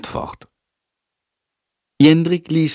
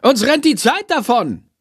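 high-pitched urgent call, no real shout; 13 semitones higher than usual. A narrator's reading. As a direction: call out that time is short, pitched well up. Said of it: "ruft sie". (hoch und drängend gerufen)

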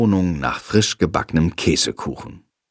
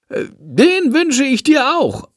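high, slow bat voice with a hesitant uh; bat replying; 13 semitones higher, slower than usual. Acted. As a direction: answer politely as a little bat. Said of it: "antwortet Shandor".

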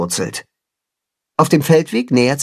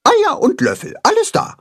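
much higher chicken voice; answering. Acted. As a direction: chirp the answer. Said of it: "antwortet das Blumenhuhn".

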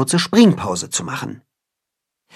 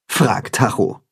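usual speaking voice

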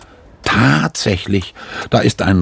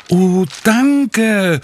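high, drawn-out thanks; delighted cry; 12 semitones higher than usual. A narrator's reading. Sophie thanking the guard for the letter, delighted high cry. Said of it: "ruft Sophie".

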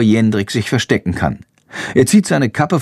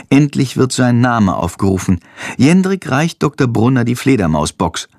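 ordinary delivery, nothing marked

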